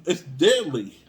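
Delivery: loud It's deadly with deadly stressed; emphatic. Real, not acted. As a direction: punch It's deadly loudly. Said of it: "I'm being serious".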